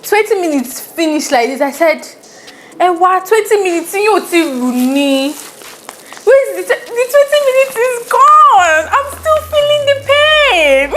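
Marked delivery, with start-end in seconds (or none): 2.8-10.9 disbelief climbing to a very high wail; outraged yelling. Retold or imitated. Wife in labour after twenty minutes, outraged high yell. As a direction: re-enact a loud, high protest, building to a scream. Retold outburst when epidural fails.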